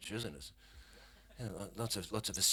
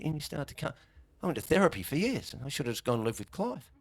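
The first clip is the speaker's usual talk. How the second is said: normal talking voice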